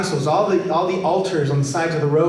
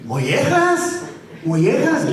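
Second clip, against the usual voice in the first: put-on high, slow, drawn-out old man's answer; grandpa voice; 9 semitones higher, slower than usual. Grandpa telling his grandson the dish's name, high and slow. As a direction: stretch it like a puzzled grandpa.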